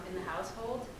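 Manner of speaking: ordinary talk, nothing marked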